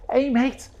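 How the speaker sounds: full-on Scouse, high and loud; hammed-up, high-pitched Scouse. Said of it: "go full, full, like, stereotypical Liverpool".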